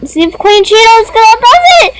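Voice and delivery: excited. Real, not acted. Excited watching pet game.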